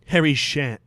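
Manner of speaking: usual speaking voice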